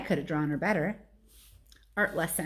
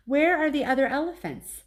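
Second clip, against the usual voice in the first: put-on raised child voice, searching; wistful little elephant; 7 semitones higher, 4 dB louder than usual. A narrator's reading. Baby elephant asks Ivan where the others are, small child voice. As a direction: ask like a lost small child.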